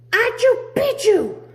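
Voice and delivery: high, loud half-sneeze; a big comic sneeze; sneezed password. Acted. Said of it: "half sneezed, half spoke".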